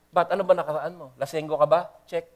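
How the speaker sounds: ordinary delivery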